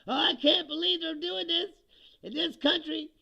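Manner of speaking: high, loud, excited disbelief; fired up. Retold or imitated. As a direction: sound thrilled that it's really happening. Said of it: "I was all fired up, like".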